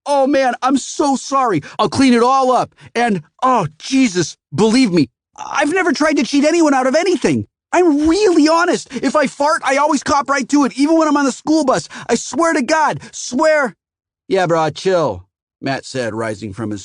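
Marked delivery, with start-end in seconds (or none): 0.1-13.8 very high, loud babbling plea; frantic apology. A narrator's reading. Scared teen pleads honesty to the customer, frantic. Panicked kid over-apologizing. 14.3-15.3 slow, easy-going drawl; laid-back warning. A narrator's reading. Matt tells the customer to chill, laid-back.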